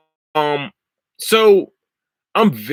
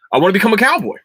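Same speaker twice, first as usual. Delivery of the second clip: much higher, louder, eager insistence; excited declaration; 9 semitones higher, 5 dB louder, much faster than usual. Real, not acted. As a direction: proclaim it like a thrilled new convert. Repeats the wish with mounting enthusiasm.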